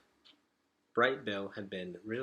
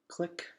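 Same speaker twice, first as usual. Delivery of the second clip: gasp; 8 semitones higher, 6 dB quieter than usual. Acted. Tense moment pressing the button.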